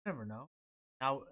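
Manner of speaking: ordinary talk, nothing marked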